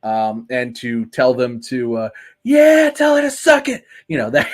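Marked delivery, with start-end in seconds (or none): 2.4-3.8 loud, high-pitched jeering yell; heckling cheer. Acted. Co-host playing that guy for a laugh, rowdy heckle.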